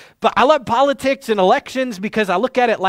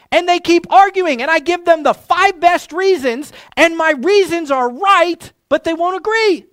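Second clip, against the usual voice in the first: imitating an exasperated caller, higher and louder; venting his grievances, pitched up and pressing; frustrated caller; 6 semitones higher, 4 dB louder than usual. Radio caller vents to the host, exasperated and loud. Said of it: "I'm so frustrated right now".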